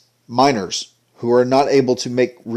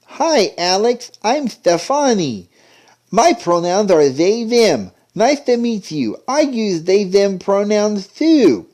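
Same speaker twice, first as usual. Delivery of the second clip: much higher, slow, syrupy mock kids; mocking sweet voices; 8 semitones higher, slower than usual. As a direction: parody cartoon kids swapping pronouns. Mocking cartoon dialogue.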